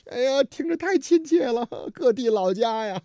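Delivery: high voice breaking into laughter; amused mock emotion. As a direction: gush over hometown accents while chuckling. 调侃各地乡音，边说边笑. (带笑地夸张感叹)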